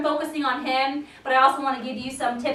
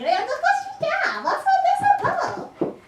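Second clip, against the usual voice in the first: much higher, slow, sing-song praise; slower than usual. Real, not acted. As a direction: coo it slowly up high to the dog. Praising the dog.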